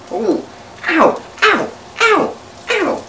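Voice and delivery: sharp high yelps as the oil spits; real startled ow ow ow; jumping back from spitting oil, yelping; yelps of pain. Real, not acted. Burnt by splashing hot oil.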